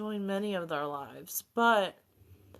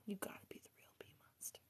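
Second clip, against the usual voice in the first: whispering; 18 dB quieter, much faster than usual. Real, not acted. Playful hushed aside to viewer.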